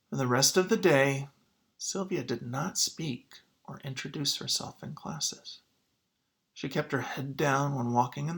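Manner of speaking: put-on clear, expressive picture-book reading for kids; story read-aloud. Teacher reading a picture book to students, expressive read-aloud. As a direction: read the story to the class, drawing them in.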